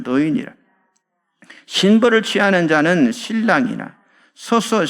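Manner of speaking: low, measured Bible reading; scripture reading. Acted. Preacher reads John's words to the congregation, low scripture reading.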